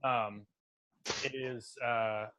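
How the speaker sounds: ordinary delivery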